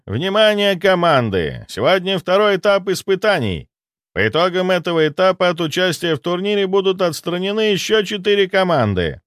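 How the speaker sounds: торжественное объявление повышенным голосом; обращайся ко всем командам, высоко и раздельно; ведущий турнира. Acted. (торжественный голос ведущего)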